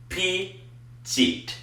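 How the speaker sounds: Petite stretched long and high; drawn-out French dictation. Shown as a demonstration. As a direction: say Petite slowly and softly. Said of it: "That's easy".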